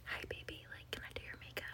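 put-on breathy whispered ASMR girl voice. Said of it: "they'll be like".

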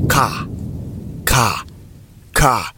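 ka with an open vowel and no R. Shown as a demonstration. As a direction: say "ka" for car, dropping the R entirely. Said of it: "instead of it being pronounced as".

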